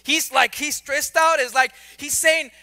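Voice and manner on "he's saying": normal talking voice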